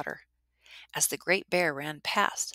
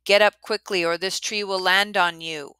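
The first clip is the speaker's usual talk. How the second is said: loud, higher urgent shout; 4 semitones higher, 9 dB louder than usual. Acted. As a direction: shout a warning to wake them. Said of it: "he shouted".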